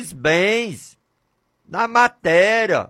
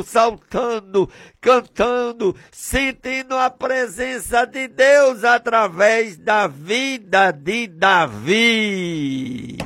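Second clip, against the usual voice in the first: ordinary delivery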